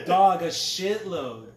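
laughing loud and drawn out. Real, not acted. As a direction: crack up while answering. Laughing boast.

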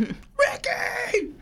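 high stretched shout; mock wail. Joking about the movie death, wailing the name. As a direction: cry the name out long.

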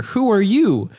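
high, loud confused demand; startled, in own voice. Acted. Asking the woman in the bed, loudly startled. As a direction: challenge a stranger in your own American voice. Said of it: "in your voice. Do you have your voice?".

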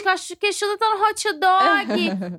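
imitating Queixuda. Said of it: "Ué, gente, o Queixuda".

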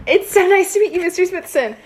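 high, eager, overly polite greeting; nervous overly polite. Pretend. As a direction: gush politely, a bit too much. Player greets NPC in character.

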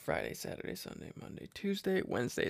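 very soft, half-voiced tallying; counting under breath. Real, not acted. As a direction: count off the days quietly to yourself. Counting days to himself.

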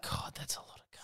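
a hushed, breathless whisper. Real, not acted. Reacting to the absurd story line in a hushed whisper. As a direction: mutter it under your breath. Stunned aside.

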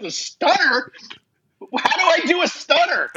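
high, incredulous, breaking into a laugh; laughing disbelief. Retold or imitated. Asking his opponent mid-match, laughing in disbelief. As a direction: laugh through the question in disbelief. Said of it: "I said".

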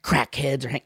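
put-on Southern accent. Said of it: "me to do a Southern accent".

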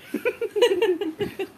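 high squealing laugh; real laugh rising high. Real, not acted. Laughs after mock thanks.

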